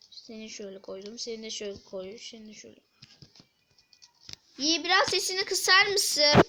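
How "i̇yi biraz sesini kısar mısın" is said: raised, higher call across the room. Real, not acted. Asking someone nearby to turn volume down, loud call.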